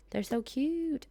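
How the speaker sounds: usual speaking voice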